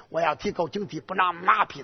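usual speaking voice

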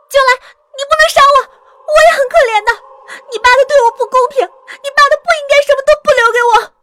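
fast, very high and loud pleading; terrified shrieking. Acted. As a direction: scream for your life, words tumbling out. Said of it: "方环吓得尖叫起来". (惊恐尖叫着急速哀求)

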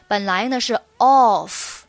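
ordinary talk

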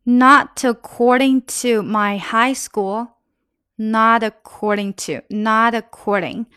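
slow, careful model reading. Shown as a demonstration. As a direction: lay each word out slowly for learners. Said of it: "teacher slows the line for learners". (放慢速度示范朗读)

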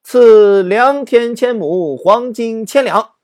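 high, loud and slow, granting with relish; the pleased emperor booms his reward; delighted royal grant. Acted. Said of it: "赵匡胤很高兴啊". (皇上高兴地大声恩准)

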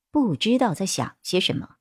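plain reading voice

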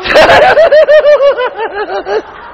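high laugh. Real, not acted. Laughing after mock wail.

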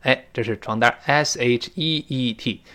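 ordinary delivery

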